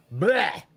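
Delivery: short loud gagging bleh; a mock gag at the idea of Clamato juice; disgusted bleh. Real, not acted. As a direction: spit the sound out in disgust.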